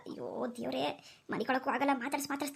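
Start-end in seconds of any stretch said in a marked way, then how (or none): 0.1-0.8 soft, low resigned groan; weary sigh. Acted. Old woman resigned to hearing the ghost all night, a soft groan. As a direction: sigh it out, defeated.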